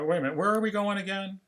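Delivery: acted sudden loss of track; confused. Replays losing track once more.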